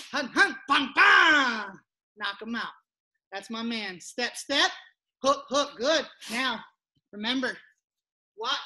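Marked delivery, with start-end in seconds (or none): none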